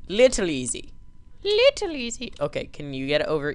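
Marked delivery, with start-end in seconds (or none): 1.4-2.3 squeaky, high-pitched repeat; playful high falsetto; falsetto echo. Pretend. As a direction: jump up into a silly high voice. Repeats phrase, second in falsetto.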